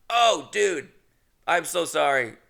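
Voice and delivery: sudden apologetic realization. Retold or imitated. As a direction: blurt it out like you just remembered. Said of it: "would have been to go".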